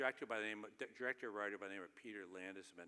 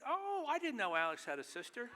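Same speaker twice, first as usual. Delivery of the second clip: higher, louder mock astonishment; mock surprise; 12 semitones higher, 6 dB louder than usual. Author retells his reply to the actor, in higher, louder mock surprise. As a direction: ham up a naive surprise.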